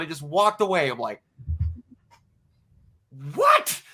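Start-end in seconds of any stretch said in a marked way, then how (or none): 3.3-3.8 much higher, loud incredulous "what?"; stunned what. Retold or imitated. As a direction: squeak it out in pure disbelief. [name] remembers him, walks off.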